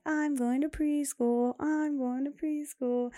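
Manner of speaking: put-on high, slow sing-song of a little kid; little girl's voice. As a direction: chant it like an excited preschooler. Daughter repeating it excitedly.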